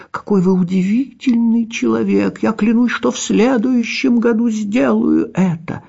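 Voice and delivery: high, earnest little cat voice. A narrator's reading. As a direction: vow it in a small high cat voice. Cat character held voice.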